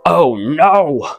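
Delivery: high-pitched, exaggerated "oh no"; mock dismay. Commenter hurt by second grade grammar, mock dismay.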